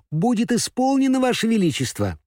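high, eager little mouse voice; squeaky mouse servant. Acted. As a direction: chirp ваше величество eagerly. Ladies-in-waiting answer the queen.

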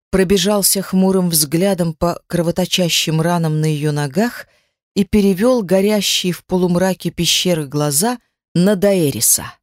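ordinary delivery

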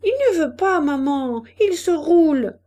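put-on high, loud child's protest; exasperated little girl reporting; calling back. A narrator's reading. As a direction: call to mother, pitched well up. Child calls back to her mother.

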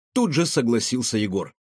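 ordinary delivery

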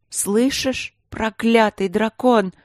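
high, slow furious cry; furious shout echoing after the dragon; angry shout. Acted. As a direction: shout it down the corridor.

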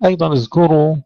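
ordinary talk

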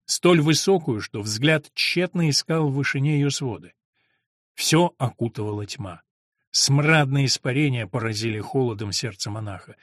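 plain reading voice

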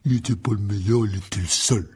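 gruff big man interrupting; cutting in. A narrator's reading. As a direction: cut the other person short firmly. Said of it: "coupa Hagrid". (il coupe la parole, bourru)

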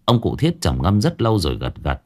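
usual speaking voice

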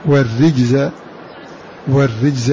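والرجز with a thin bright ri; light ra. Shown as a demonstration. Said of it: "فإنه رققها". (والرجز بترقيق الراء)

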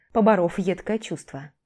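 ordinary delivery, nothing marked

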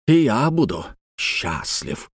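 slow, halting, grudging acceptance; sour reluctance. A narrator's reading. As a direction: say it slowly with a sour grimace. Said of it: "с кислой гримасой".

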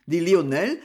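ordinary delivery, nothing marked

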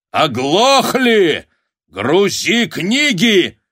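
high, loud, drawn-out bellow; roared order. A narrator's reading. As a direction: roar at them to obey. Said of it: "рявкнул Шишкин". (яростный рявк)

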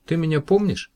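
put-on high bright girl's question. A narrator's reading. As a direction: lift it up high like a young woman. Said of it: "girl voiced in story".

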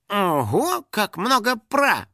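high, drawn-out wonder; cheeky awe; impressed amazement. A narrator's reading. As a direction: marvel at it, nearly whistling. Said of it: "присвистнул финик".

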